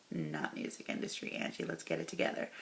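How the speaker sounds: breathy muttered self-correction; whispered aside. Real, not acted. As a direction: mutter to yourself, almost whispered. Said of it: "muttering self-correction".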